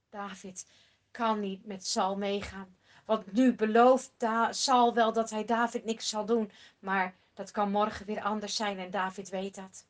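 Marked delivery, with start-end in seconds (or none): none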